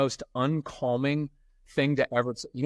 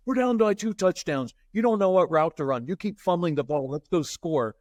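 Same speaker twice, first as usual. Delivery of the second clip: ranting quarterback; 5 semitones higher than usual. Retold or imitated. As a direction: tear into the huddle like a panicking quarterback.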